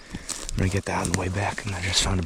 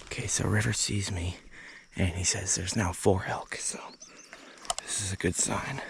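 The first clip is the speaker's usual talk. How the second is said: hushed whisper near the elk; wildlife watching whisper; 6 dB quieter than usual. Real, not acted. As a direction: whisper so the herd won't hear. Stalking elk, keeping quiet.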